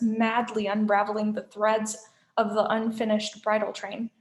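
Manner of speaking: usual speaking voice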